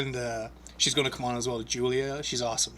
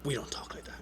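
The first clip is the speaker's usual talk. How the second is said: whispered warning; 11 dB quieter than usual. Retold or imitated. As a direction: lean in and whisper it. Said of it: "I'm like".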